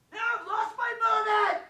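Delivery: loud exasperated yell; frustrated shout. Real, not acted. Frustrated outburst at interruption.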